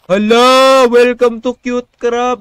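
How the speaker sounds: high, loud, drawn-out welcome; excited greeting. Real, not acted. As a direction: greet a new viewer loudly, stretching the words. Greets newcomer to stream. (masiglang pagbati)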